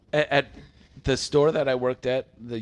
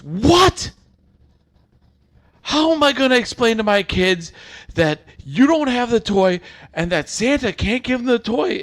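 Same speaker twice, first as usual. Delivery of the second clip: much higher and louder, shrill-free mock outrage at full pitch; imitating a yelling customer; 11 semitones higher, 10 dB louder than usual. Customer yelling at a toy store clerk, high and loud. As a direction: squeal it like a mom robbed of Christmas. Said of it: "just starts yelling at me".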